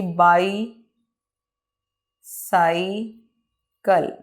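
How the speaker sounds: Bicycle with long gaps, bi, cy, cle; drawn-out dictation of bicycle in three parts; very slow, spaced beats on bicycle; broken into syllables. Shown as a demonstration. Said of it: "word को रुक रुक के बयान करना".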